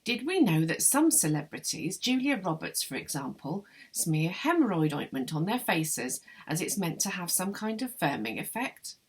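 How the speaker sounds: plain reading voice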